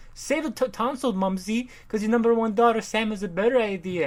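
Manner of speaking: high-pitched hammed-up socialite girl; put-on rich daughter. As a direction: play the cocky society daughter. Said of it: "The daughter is like".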